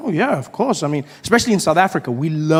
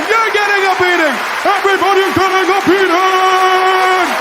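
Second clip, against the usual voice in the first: frantic yelling; 13 semitones higher, 5 dB louder than usual. Acted. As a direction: announce beatings like giving away prizes. Teacher beating everyone, Oprah-style.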